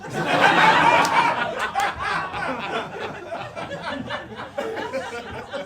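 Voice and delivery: loud high laughter; big laugh. Real, not acted. Laughing with the audience after the insult, loud. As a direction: crack up at the punchline.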